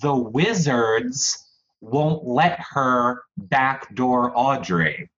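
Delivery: slow, drawn-out dramatic recap; exaggerated telling. Housemate gossip told to co-hosts, exaggerated. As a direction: stretch each word for drama. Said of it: "Mister Exaggeration in the corner".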